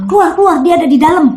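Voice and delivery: panicked order to leave the room; frightened urging. Retold or imitated. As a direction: get them out, scared. Mother urging kids out.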